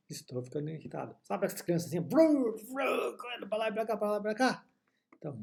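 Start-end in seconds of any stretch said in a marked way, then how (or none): 2.0-3.2 put-on playful high vroom noise like kids racing; imitated engine vroom. Teacher mimics noisy children running around, mock vroom. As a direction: buzz out a toy car sound.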